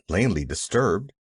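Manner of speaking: ordinary delivery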